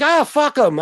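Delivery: high, loud mock brush-off; carefree dismissal. Mimicking a careless friend who shrugs off the cops, in a high loud brush-off. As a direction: toss it off like nothing can touch him.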